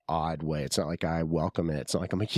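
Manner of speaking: ordinary talk, nothing marked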